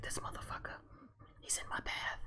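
unvoiced whispered inner voice; murderous whispered thought. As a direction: hiss it to yourself like a killer's thought. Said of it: "He's like".

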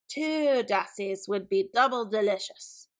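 lightly voiced bird of prey; a hint of eagle villain; greedy eagle. A narrator's reading. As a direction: relish the thought of a double meal. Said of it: "he thought".

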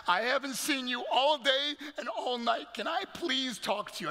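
acted hurt, urgent appeal; pleading mother. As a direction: imitate a mom begging for a moment.

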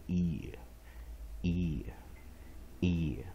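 "ear" drawn out slowly, ee gliding into a schwa; pronunciation of "ear". Shown as a demonstration. Teacher drilling the I A ending, slow demonstration of "ear". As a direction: say each "ear" long, low and separate. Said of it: "I A结尾就是念ear".

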